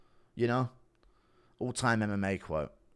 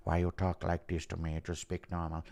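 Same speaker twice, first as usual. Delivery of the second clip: Francis Ngannou; 4 semitones lower, 4 dB quieter than usual. Acted. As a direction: lower imitated fighter voice. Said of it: "Impression of Ngannou and McConaughey".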